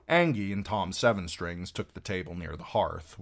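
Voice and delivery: plain reading voice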